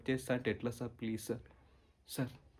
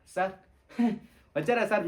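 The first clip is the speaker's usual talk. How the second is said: caught-out giggle, higher and louder; nervous laugh; 6 semitones higher, 4 dB louder than usual. Acted. Student caught gossiping greets the maths sir with a nervous laugh. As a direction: laugh it off guiltily.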